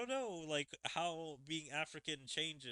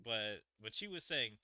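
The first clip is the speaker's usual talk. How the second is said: ordinary delivery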